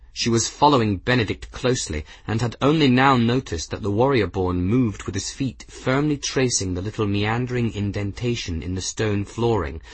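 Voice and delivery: usual speaking voice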